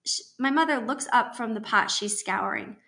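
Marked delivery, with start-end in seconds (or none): none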